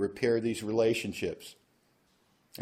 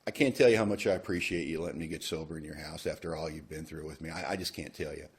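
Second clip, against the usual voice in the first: softer, lower, sincere-sounding; mock humble apology; 7 semitones lower, 6 dB quieter than usual. Sponsor scripting his apology to sister, soft and sincere. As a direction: coach the words gently, like a model apology.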